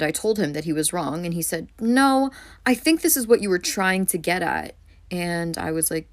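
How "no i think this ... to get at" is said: higher, louder mock insistence on the first word; mock know-it-all correction; insistent mansplainer. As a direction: imitate a man overruling someone. Retelling man insisting she is wrong.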